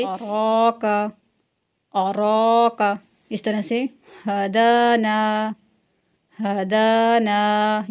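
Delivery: slow drawn-out Quranic reading; tajweed recitation. Shown as a demonstration. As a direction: recite each word for students to repeat. Recites practice words. (धीमी तिलावत वाली आवाज़)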